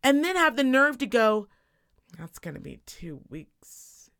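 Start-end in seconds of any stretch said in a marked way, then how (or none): 2.2-4.1 very soft, low, slow imitation; mock indifferent clerk. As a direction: drag it out quietly, mimicking an unhelpful clerk. Mimicking the clerk's unhelpful attitude.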